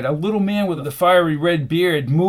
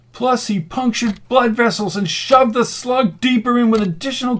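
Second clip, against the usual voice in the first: high, agitated complaint; indignant ranting; 7 semitones higher than usual. A narrator's reading. Rasputin reports the botched treatment, indignant. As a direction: rail against their incompetence.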